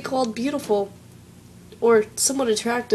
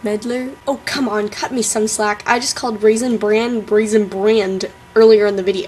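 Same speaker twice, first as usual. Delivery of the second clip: ordinary delivery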